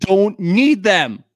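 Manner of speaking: loud, high, every word drawn out; emphatic insistence. Real, not acted. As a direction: hammer it home slowly and loudly. Emphatic rant about pointless messages.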